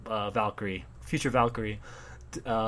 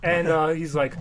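ordinary delivery

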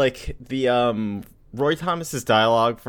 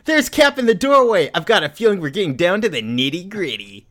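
much higher mocking kid voice; sassy kid; 5 semitones higher, 3 dB louder than usual. Mocking the comic dialogue, high sassy kid. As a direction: squeak it out like a cocky teen. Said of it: "reading Rick's dialogue".

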